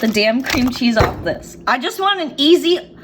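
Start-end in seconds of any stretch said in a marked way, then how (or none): 1.7-3.0 higher-pitched, whiny frustration; exasperated. Real, not acted. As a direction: complain as you wrestle with a stubborn package. Struggling with cream cheese packaging.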